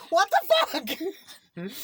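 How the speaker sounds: very high, loud, drawn-out shriek through laughter; hammed-up slow what the fuck yell; shocked yell. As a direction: stretch it out in laughing disbelief. Retold shock at burned finger.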